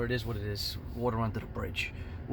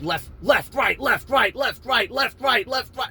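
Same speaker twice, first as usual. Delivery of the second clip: high, loud alternating beats; pounding punch rhythm; 10 semitones higher, 5 dB louder, slower than usual. Retold or imitated. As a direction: chant each word like a landing punch.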